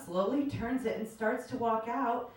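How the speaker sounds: ordinary talk, nothing marked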